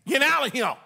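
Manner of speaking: put-on high, loud shout at the kids; hammed-up parent shouting the kids out; mock parent yell. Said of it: "Maybe you said it like this".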